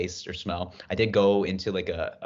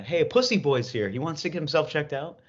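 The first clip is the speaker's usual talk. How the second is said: higher, mocking announcer call; mocking receptionist; 8 semitones higher than usual. Receptionist announcing the patient, mocking call. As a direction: announce him like a joke to the room. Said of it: "the guy's like".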